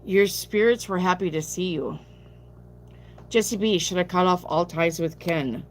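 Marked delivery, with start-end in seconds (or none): none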